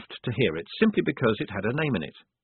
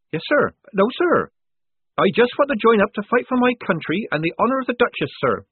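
high eager recruit voice; nervous young recruit; 13 semitones higher, 5 dB louder than usual. Acted. Polly eager.

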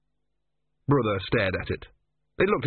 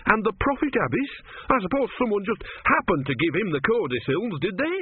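higher-pitched indignant sarcasm; shaking with anger; 6 semitones higher than usual. A narrator's reading. Brother confronting the tortoise over the codicils, angry. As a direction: challenge him in angry disbelief, voice raised in pitch. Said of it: "Brother was shaking with anger".

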